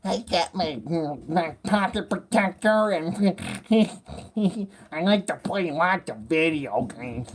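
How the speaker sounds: mildly hammed-up nerd bragging; lightly voiced nerd. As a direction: nerd figure showing off his pocket protector. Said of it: "And he's even got his little".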